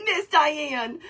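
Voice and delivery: usual speaking voice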